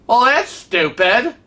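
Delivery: slow, high-pitched incredulous judgement; indignant disbelief. Retold or imitated. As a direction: draw it out as if appalled. Acting out her indignant reaction.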